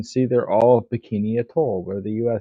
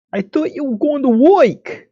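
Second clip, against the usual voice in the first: much higher and louder, put-on voice; mock character voice; 14 semitones higher, 6 dB louder than usual. Player plays the housemate, high-pitched mock surprise. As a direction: squeak it out in a high, silly voice.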